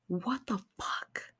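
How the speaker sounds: whispered, slowed-down what the fuck; slow stunned whisper. Retold or imitated. Girl whispering in disbelief at grandma's secret. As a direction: drag it out in a shocked whisper.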